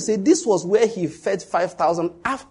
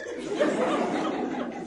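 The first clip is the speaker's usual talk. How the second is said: squealing laugh; a breathless giggle; high breathy laugh; 9 dB quieter than usual. Real, not acted. Laughing at own story.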